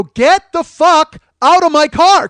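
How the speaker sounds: slow, high, very loud roar; loud scary yell. Retold or imitated. Yelling slowly at the woman to scare her out of his car. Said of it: "I'm getting louder, trying to scare her".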